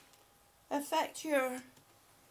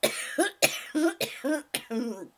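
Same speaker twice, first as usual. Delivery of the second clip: loud run of hacking coughs; coughing; 10 dB louder than usual. Real, not acted. Coach breaking off chat reading, loud coughing fit. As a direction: cough hard several times, easing at the end.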